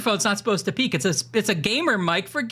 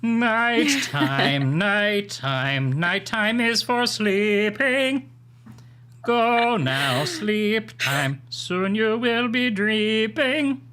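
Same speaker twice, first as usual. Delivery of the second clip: Phantom voice; much slower than usual. Pretend. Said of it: "that was my Phantom impression".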